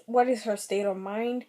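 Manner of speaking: ordinary talk, nothing marked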